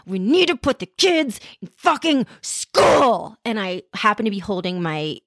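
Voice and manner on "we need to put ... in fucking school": raised, slowed-down exasperated outburst; imitating her own outburst, high and slow; retold outburst. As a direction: snap it out like you've lost it. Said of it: "And I went bananas".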